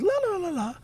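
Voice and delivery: high, singsong mock la-las; mimicking oblivious fiancée. Storyteller imitating his fiancée unaware of her surroundings, carefree singsong. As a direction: trill it carelessly high, imitating someone oblivious.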